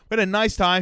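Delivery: ordinary delivery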